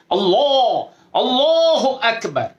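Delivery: আল্লাহু আকবার lifted high and stretched slow; full-mouthed takbir. Shown as a demonstration. Teacher modeling the proper full-throated takbir. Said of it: "মুখটাকে ভরে".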